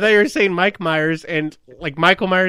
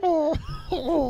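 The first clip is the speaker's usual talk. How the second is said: Winnie the Pooh; 10 semitones higher, 4 dB quieter than usual. Acted. Showing friends the cartoon bear, high old-man voice. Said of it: "that's how Winnie the Pooh sounds".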